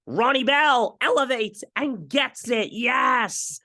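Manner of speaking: high, slow, thrilled call of the catch; leaping up in pitch, drawn-out celebration; excited play call. Real, not acted. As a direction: shout the catch like a fan who can't believe it.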